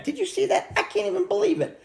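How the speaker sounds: very high, incredulous kid imitation; mock amazement. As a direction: pipe it up in mock astonishment. Acting out his childhood disbelief.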